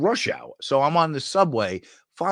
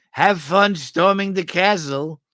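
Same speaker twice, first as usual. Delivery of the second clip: louder, higher playful line reading; movie quote; 7 semitones higher, 6 dB louder, slower than usual. Retold or imitated. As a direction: recite it like the film. Quoting Princess Bride lines.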